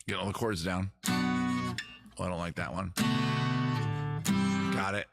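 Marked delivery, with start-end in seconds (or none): none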